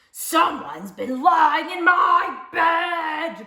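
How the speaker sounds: slow, loud, gruesome growl; gruesome bear growl. A narrator's reading. Big bear finds his bed slept in, growling. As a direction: growl it like an enormous riled bear. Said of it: "growled the big bear in his great, big, gruesome voice".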